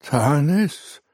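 high, stunned repeat of Tanis; a jolted, rising Tanis?; shaken disbelief. A narrator's reading. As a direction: echo the name in shock. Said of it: "wiederholte Vanion erschüttert".

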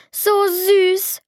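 hohes, entzücktes Quietschen wie eine Maus; quietschend vor Begeisterung. Acted. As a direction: quiek es hoch und hingerissen heraus. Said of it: "quietschte ich selbst wie eine Maus". (hohes Mäusequietschen vor Entzücken)

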